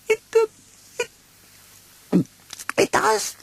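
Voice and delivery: very high, stammering little pig; scared Piglet. Acted. Piglet doubts Pooh's choice, high and stammering. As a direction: stutter it like a frightened piglet.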